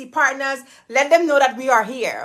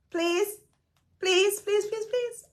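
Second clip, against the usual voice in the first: high, soft pleading that climbs higher with each please; high whiny begging; 9 semitones higher, 6 dB quieter, slower than usual. Real, not acted. Streamer begging chat to subscribe, high whiny plea.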